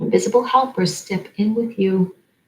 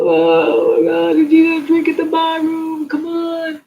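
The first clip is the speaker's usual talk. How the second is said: high, hammed-up exasperated angel voice; mock frazzled angel; 10 semitones higher, 4 dB louder than usual. Mocking angels who'd run to the bar, high and hammed-up. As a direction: play a fed-up angel begging for a drink, pitched well above your own voice. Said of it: "but not to go".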